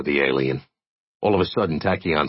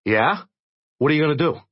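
higher, doubtful challenge; skeptical young man; 9 semitones higher, faster than usual. Acted. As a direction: call his bluff. Jay challenges Tachyon.

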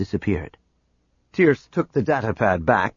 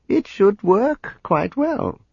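casual; 10 semitones higher, slower than usual. A narrator's reading. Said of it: "Dizra spoke casually".

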